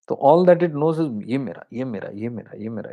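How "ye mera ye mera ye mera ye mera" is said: soft, fading murmur of mine; a clinging soul murmuring, softer and softer; a ghost muttering mine mine; possessive murmur. Retold or imitated.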